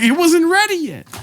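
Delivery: very high-pitched wail of complaint; squeaky-high comic outrage; mock protest. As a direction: protest as the wronged goat owner, voice way up. Acts the goat owners protesting.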